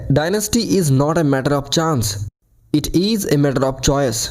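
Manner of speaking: slow, with Dynasty and choice punched hard; stress on Dynasty and choice. Shown as a demonstration. Teacher demonstrating emphasis, slow with Dynasty and choice stressed. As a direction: lean on Dynasty, then land heavily on choice. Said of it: "dynasty और choice जैसे शब्दों पर ज़्यादा जोर देंगे".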